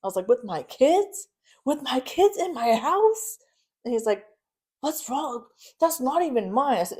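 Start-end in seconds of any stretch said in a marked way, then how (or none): 0.3-3.4 slow, high incredulous demand; outraged. Retold or imitated. Confronting him about her kids in the house, outraged. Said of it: "crying 'cause I'm pissed". 4.8-6.8 defensive denial. Retold or imitated. Husband denies it when confronted.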